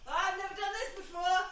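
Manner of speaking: loud, high heroic shout. Acted. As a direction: yell it like a battle cry. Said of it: "he shouts".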